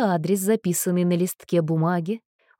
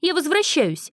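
defiant cry; 9 semitones higher than usual. Acted. Jilted girl defiantly shouts she is returning. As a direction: storm back in with a cry.

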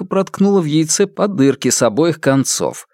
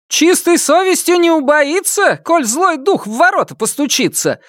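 mocking shout. A narrator's reading. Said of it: "крикнула ей вслед".

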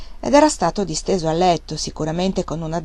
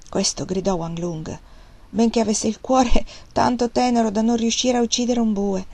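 ordinary delivery

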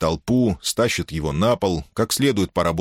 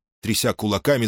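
ordinary delivery

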